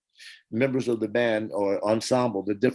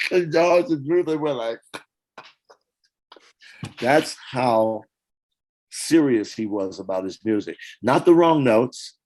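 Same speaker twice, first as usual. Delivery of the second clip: usual speaking voice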